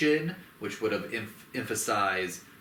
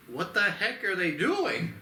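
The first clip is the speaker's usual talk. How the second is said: baffled high voice; 10 semitones higher than usual. Retold or imitated. Voicing Kong seeing enslaved apes.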